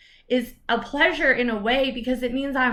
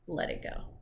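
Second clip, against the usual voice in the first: whispered; 10 dB quieter than usual. Real, not acted. Alludes to song.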